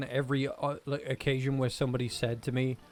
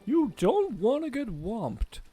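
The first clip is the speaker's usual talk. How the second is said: lightly voiced Toad warning; 9 semitones higher, 4 dB louder, slower than usual. Voicing game character.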